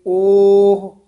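ਓਹ held long and loud; loud, sustained ਓਹ closing on h; lengthened open o. Shown as a demonstration. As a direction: stretch the open o of ਓਹ. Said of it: "ਖੁੱਲੇ ਮੂੰਹ ਵਾਲਾ ਵਧਾ ਦੇਵਾਂਗੇ".

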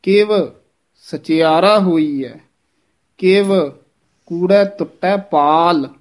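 ਕਿਵ, pause, measured phrase; pause after word. Shown as a demonstration. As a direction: clear break after each ਕਿਵ. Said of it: "ਯਮਕ ਲਾ ਕੇ ਉਚਾਰਨ ਕਰਨਾ ਹੈ".

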